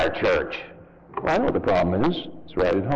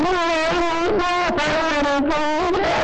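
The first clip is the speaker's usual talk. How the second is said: put-on reluctant kid; faster than usual. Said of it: "And the kid says".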